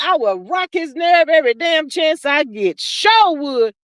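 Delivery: ordinary talk, nothing marked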